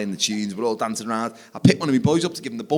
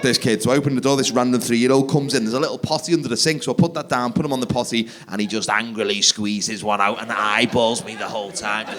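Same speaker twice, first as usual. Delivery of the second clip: ordinary talk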